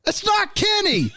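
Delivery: very high, stretched-out mimicked shout; imitated far-off yelling, high and slow; yelling from the yard. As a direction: holler a correction across a yard. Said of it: "somebody in the yard goes".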